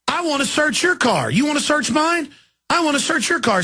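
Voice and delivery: high-pitched, indignant comeback; retold defiant demand. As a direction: re-enact turning the tables on a cop. Acted confrontation with a cop.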